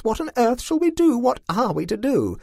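high, loud panicked questions; frantic high-voiced pleading; panicked cry. A narrator's reading. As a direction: cry out helplessly in alarm, pitched high. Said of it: "screamed Constance".

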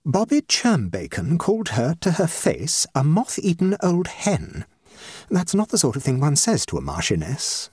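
lightly voiced put-out hostess; society hostess, indignant. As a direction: play a scandalised hostess.

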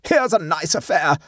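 high-pitched, indignant exclamation; understated scream of outrage; mock scream. A narrator's reading. Guest bursting into the room, high indignant cry. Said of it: "she screamed".

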